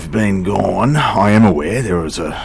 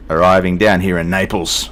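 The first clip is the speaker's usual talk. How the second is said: ordinary talk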